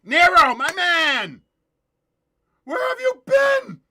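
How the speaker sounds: loud high shout to a friend; excited greeting. Real, not acted. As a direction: holler it across the room, thrilled.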